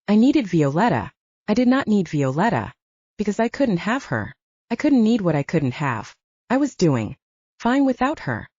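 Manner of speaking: plain reading voice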